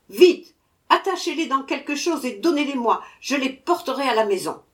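impatient dwarf ordering sweets. A narrator's reading. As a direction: rush him with orders.

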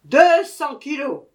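loud high demand; dwarf demanding two hundred kilos. A narrator's reading. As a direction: state the order firmly.

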